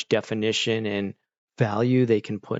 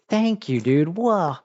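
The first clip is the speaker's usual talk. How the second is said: excited; 6 semitones higher, slower than usual. Real, not acted. Excited thanks to viewer.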